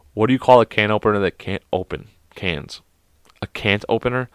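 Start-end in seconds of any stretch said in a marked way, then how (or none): none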